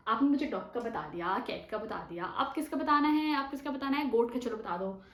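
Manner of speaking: ordinary talk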